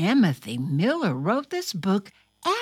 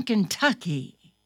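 usual speaking voice

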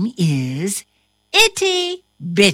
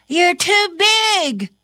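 put-on higher, gently scolding girl; Ava's voice; 9 semitones higher, 3 dB louder than usual. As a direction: tell the cat kindly but firmly. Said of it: "says Ava".

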